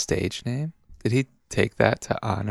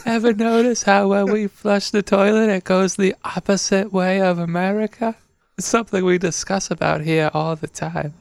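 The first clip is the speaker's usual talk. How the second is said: put-on Australian accent; 7 semitones higher than usual. Aussie chatting about toilets, mock Australian accent. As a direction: ham up an Aussie musing on toilets. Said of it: "Been working on my Australian accent".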